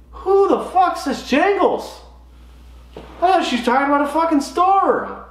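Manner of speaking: high, comic outburst of confusion; exasperated disbelief. Retold or imitated. Golfer baffled by the word jangles, high-pitched exasperation. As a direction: rant incredulously up high.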